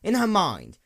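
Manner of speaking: ordinary delivery, nothing marked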